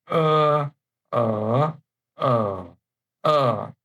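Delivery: er level, rising, dipping, then falling; er in four tones. Shown as a demonstration. Teacher reading the row aloud, er through four tones. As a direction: run er through all four tones in turn. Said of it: "pay the attention, uh, to the tones".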